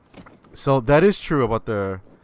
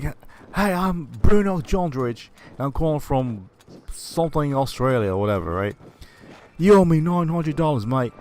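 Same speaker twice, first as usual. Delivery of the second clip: mock Australian; 6 semitones higher, 4 dB louder than usual. Father calling Bart demanding money, light Australian accent. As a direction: lay on a light Australian twang.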